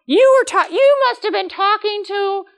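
high, loud accusation, pointing a finger from the pew; accusing congregant. Retold or imitated. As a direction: play the offended church lady who thinks the sermon was aimed at her. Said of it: "somebody in the congregation will go".

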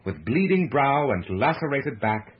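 ordinary delivery, nothing marked

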